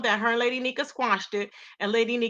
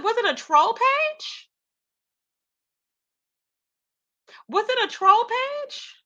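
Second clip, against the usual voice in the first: high, slow disbelieving question; mock incredulous; 11 semitones higher, slower than usual. Retelling her reaction to the troll page rumor, high and slow. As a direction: act baffled, drawn out. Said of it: "I said".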